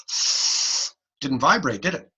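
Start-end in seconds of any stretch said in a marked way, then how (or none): 0.1-0.9 breathy F. Shown as a demonstration. Teacher showing an unvoiced F to the class. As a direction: blow the F with no voice. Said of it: "Say the letter F".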